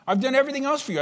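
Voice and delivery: anguished, high-pitched appeal to God; desperate plea. Retold or imitated. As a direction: push the plea up to its peak. Abraham protesting to God.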